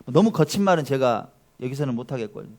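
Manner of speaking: ordinary talk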